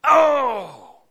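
high loud sympathetic oof; pained groan. Retold or imitated. Watching a football player get flattened, pained oof. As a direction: wince out loud at the hit. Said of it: "you go".

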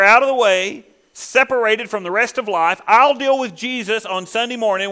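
loud, high, driving sermon delivery; impassioned preaching. Acted. Preaches at full force.

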